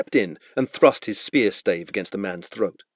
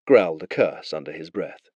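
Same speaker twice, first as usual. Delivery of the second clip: ordinary talk, nothing marked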